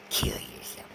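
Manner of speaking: menacing whisper; whispered threat. Retold or imitated. Imitating the voices threatening him, whispered. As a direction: breathe it like a curse. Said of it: "threatening me going".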